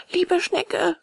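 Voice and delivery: high puppet voice calling up; high-pitched appeal. A narrator's reading. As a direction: call up in a high voice. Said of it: "rief Bengele".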